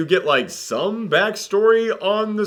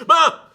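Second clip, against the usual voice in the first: sudden high, loud "ah"; mock scare yell; 11 semitones higher, 6 dB louder than usual. Reviewer interrupts himself to mimic a jump scare, sudden mock yell.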